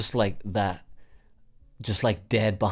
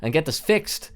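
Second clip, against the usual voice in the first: fed-up order; 6 semitones higher, 6 dB louder than usual. Retold or imitated. Fed up, ordering her to hospital.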